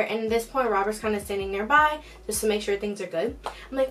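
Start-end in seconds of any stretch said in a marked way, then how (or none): none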